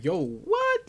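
sudden very high squeak of shock; shocked yelp. Retold or imitated. Renovo reacting to Amiri's comeback, shocked high yelp. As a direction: jump way up in pitch, caught off guard. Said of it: "Even Renovo is surprised".

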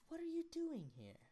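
high-pitched British mother; mistaken British accent. Acted. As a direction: ask it as a high-voiced British woman. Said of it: "she's not British, she's Indian".

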